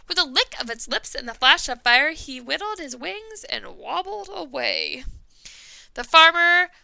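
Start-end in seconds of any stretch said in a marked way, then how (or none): none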